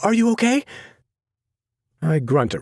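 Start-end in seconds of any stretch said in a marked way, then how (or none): 0.0-0.6 put-on high, anxious young woman; high breathless worry; frantic girl's voice. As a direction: cry out in worry. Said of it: "she screams".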